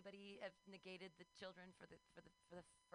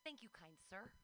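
ordinary talk, nothing marked

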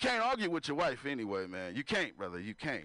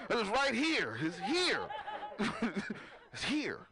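laughing; 3 semitones higher, slower than usual. Acted. Pointing at tattoo order.

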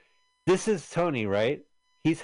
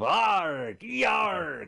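high mock pirate "Arg, yarg"; a hammed-up, high pirate cry; pirate growl; 10 semitones higher than usual. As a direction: ham up a movie pirate's growl. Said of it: "We think of".

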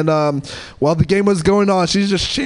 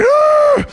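very high loud scream; shrieking scream; 6 dB louder than usual. Retold or imitated. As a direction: let out a piercing shriek. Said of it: "Darcy was just like".

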